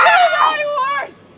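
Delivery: a loud, sobbing, defiant cry; tearful defiance. Acted. Maid defying her mistress's killer, crying and shouting. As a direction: throw the dare back through tears. Emilia defies Othello.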